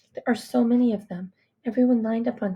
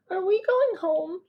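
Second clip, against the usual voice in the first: put-on tired little boy; 9 semitones higher, slower than usual. Tired little brother asks.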